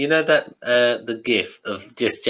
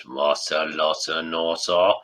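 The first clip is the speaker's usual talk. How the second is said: Jabba gibberish; 6 semitones lower than usual. Retold or imitated. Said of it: "Jabba goes".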